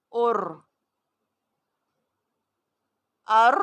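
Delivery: alternating heavy and thin ار; ار drill, thick and thin; contrasting ar drill. Shown as a demonstration. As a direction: let them hear the heavy and thin ار. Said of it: "سو فرق آپ سنیے".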